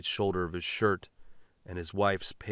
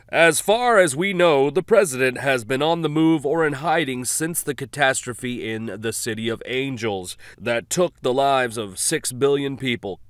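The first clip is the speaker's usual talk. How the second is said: loud, raised news-anchor read; radio announcer; 5 semitones higher, 9 dB louder than usual. Acted. Radio announcer reporting an attack, news read. Announcer back after clip.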